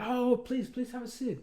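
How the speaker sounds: high-pitched imitation of a gracious host; high, over-polite invitation, retold; welcoming official. Police head seating his visitor, welcoming official.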